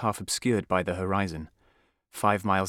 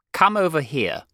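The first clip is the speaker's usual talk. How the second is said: raised, loud command; stern headmaster; 7 semitones higher, 6 dB louder than usual. A narrator's reading. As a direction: summon the culprit with authority. Headmaster orders boy forward.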